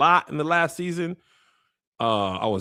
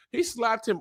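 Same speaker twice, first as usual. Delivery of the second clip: usual speaking voice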